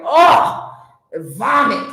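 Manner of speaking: disgusted demon. Retold or imitated. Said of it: "Even the demon was like".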